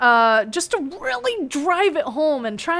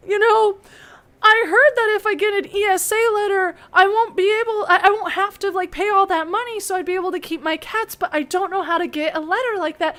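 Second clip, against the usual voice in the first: tearful pleading; 6 semitones higher than usual. Retold or imitated. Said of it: "she needs a little bit more push".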